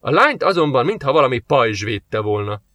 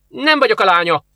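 much higher, louder furious retort; angry snort; 9 semitones higher, 4 dB louder than usual. A narrator's reading. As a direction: bristle at being called his daughter. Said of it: "horkant fel Nádja dühösen". (dühös felhorkanás)

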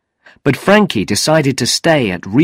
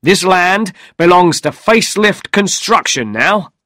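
mildly angry, higher-pitched assertion; 8 semitones higher, 3 dB louder than usual. A narrator's reading. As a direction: lay down the law with some anger. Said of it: "the man said angrily".

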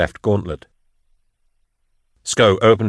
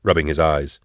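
plain reading voice

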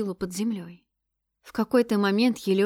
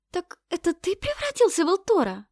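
surprised; 10 semitones higher than usual. A narrator's reading. Girl asks astonished question.

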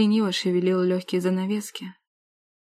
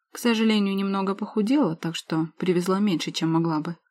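usual speaking voice

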